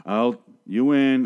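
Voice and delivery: stretched out, drawn slow; slow, solemn movie delivery; slow mock quote. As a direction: draw out the words like a dramatic line. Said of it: "they kept saying".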